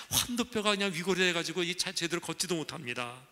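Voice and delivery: ordinary delivery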